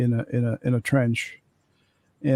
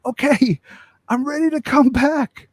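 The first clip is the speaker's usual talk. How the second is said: much higher voice calling from far off; distant call; 12 semitones higher than usual. Retold or imitated. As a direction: call across the battlefield. Distant soldier calling back.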